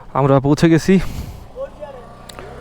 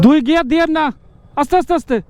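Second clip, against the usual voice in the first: shouting warnings; 9 semitones higher, 6 dB louder, faster than usual. Real, not acted. Calling warnings on steep climb.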